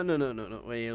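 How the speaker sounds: ordinary delivery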